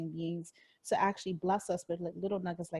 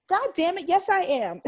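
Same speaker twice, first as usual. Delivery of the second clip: much higher and louder, triumphant; fired-up self-affirmation; 11 semitones higher, 10 dB louder than usual. Retold or imitated. Host acting out answering her mirror notes, fired up. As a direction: shout it back at the mirror notes. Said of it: "I'm like".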